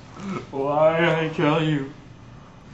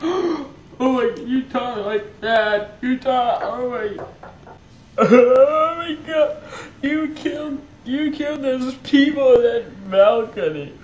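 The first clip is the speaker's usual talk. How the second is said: higher, crying disbelief; shocked distress; 6 semitones higher than usual. Acted. Nephew shocked by his uncle's words, crying. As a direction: gasp and sob through it.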